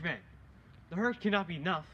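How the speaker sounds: normal talking voice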